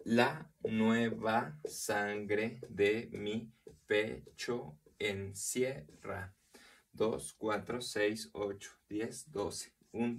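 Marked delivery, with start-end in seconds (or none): 0.0-6.3 very slow, every syllable set apart; syllable-by-syllable scansion. Shown as a demonstration. Teacher counting the syllables of a verse, slow scansion. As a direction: break the verse into separate, measured syllables.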